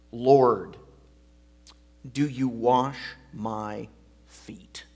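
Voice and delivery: Lord, do you wash my feet, slow and loud; Peter's objection. Shown as a demonstration. Preacher voicing Peter's protest to Jesus, slow and loud. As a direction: weigh each word of the objection. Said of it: "And what he means by that is".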